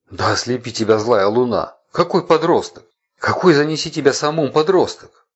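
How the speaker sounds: high, loud furious inner cry; angry outburst. A narrator's reading. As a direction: curse in furious disbelief.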